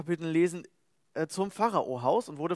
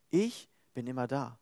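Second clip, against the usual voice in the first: calm assurance; 5 semitones lower, 5 dB quieter than usual. Acted. God's promise repeated.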